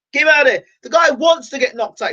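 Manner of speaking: high, loud urgent demand; urgent plea. Real, not acted. Fan pleading for Berto to be pulled out, loud and high. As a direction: yell for them to pull him out. Begging for the fight to stop.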